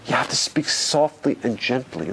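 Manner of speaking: usual speaking voice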